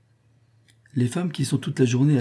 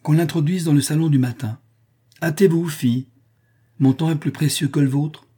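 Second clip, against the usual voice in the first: higher, animated orders from a mistress; 7 semitones higher, 4 dB louder than usual. A narrator's reading. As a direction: give orders with eager urgency. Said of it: "répondit Eugraphia avec animation".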